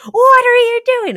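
high, loud outraged yell; indignant shout. Retold or imitated. Girls confronting the guys with an outraged yell. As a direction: call them out sharply. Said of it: "they're like".

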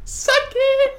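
triumphant shriek. Real, not acted. Gloating after winning round.

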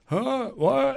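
high, soft puzzled murmur; small high-pitched confusion; confused crowd. Retold or imitated. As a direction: imitate baffled audience members.

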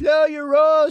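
shout. Acted. Interrupting loudly.

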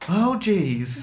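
high-pitched mock kid's groan; embarrassed kid. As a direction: imitate a kid sitting beside an oversharing mother. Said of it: "the kid's just like".

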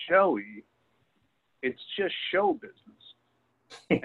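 slow, high-pitched, drawn out "show business"; lightly voiced older woman soothing Joey. Coach's wife telling Joe Namath it's just show business, slow and high. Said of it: "she said".

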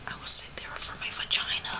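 whispered aside. Real, not acted. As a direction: whisper it secretly.

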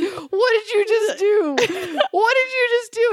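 high, laughing incredulous question; gleefully hammed-up disbelief; mock disbelief. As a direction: tease through rising laughter. Mocking boyfriend while filming him.

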